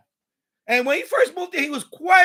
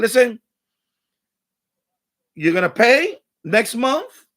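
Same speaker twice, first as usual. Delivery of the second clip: stern warning; 5 dB louder, slower than usual. Retold or imitated. Landlord laying down the law.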